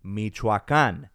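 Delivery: Michoacan drawn out slowly, stressed -can at the end; slow teaching say-along. Shown as a demonstration. Asking viewers to say Michoacan along, slowly. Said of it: "Say it with me".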